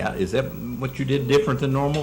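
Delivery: talking through a mouthful; words around a bite of food; mouth full. Real, not acted. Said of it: "while you have your mouth full".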